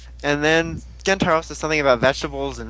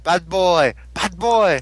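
mock scolding teacher; 3 semitones higher, much slower than usual. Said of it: "smacking Gentaro over the butt and saying".

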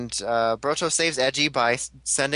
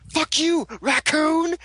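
mock battle yell; 13 semitones higher, much slower than usual. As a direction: yell the insult like a hero's war cry. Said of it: "so Edgy's like".

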